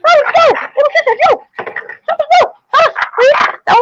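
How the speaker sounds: high frantic mock pleading; high pleading engine. Toy engine begging Thomas to stop. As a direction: beg in a high panicky voice.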